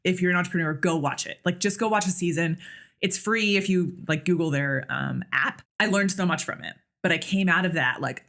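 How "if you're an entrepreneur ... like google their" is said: ordinary talk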